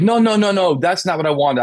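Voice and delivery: a wife begging the officer to stop the arrest; upset wife pleading. Retold or imitated. Wife protesting husband's arrest.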